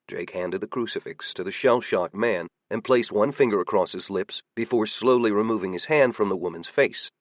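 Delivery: usual speaking voice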